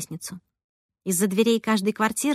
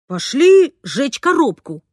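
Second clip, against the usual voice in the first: suggesting; 4 semitones higher, 6 dB louder, slower than usual. A narrator's reading. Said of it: "предложил папа".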